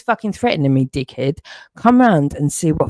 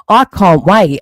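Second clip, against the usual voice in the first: sudden much louder outburst; angry shout; 3 semitones higher, 8 dB louder than usual. Real, not acted. Man bursts out at the accuser with a loud shout.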